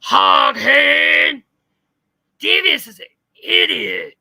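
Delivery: hammed-up imitation of her yelling; mock shouting. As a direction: bellow it as an unhinged insult. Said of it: "she was like".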